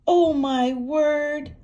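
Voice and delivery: high, loud, slow shriek. Acted. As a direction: shriek in alarm. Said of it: "shrieked Hilda".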